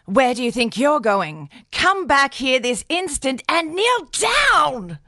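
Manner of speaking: high, slow, stern order; commanding aunt. Retold or imitated. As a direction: call him back like an outraged aunt, slow and high. Aunt ordering Joe back.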